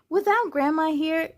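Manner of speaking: usual speaking voice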